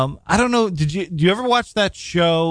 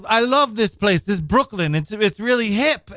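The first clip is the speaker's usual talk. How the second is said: imitated European chef accent, higher in pitch; foreign accent; 7 semitones higher than usual. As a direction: mimic a foreign chef's accent, voice lifted. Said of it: "he's got this accent".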